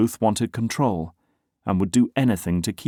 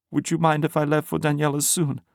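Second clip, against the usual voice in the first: higher woman's voice; 8 semitones higher than usual. A narrator's reading. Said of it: "looking beaten down".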